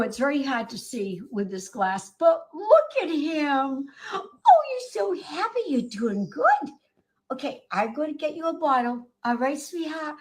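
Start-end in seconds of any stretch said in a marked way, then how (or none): 2.2-10.1 higher, sing-song cooing. Real, not acted. Rescuer cooing to her kitten, high sing-song baby talk.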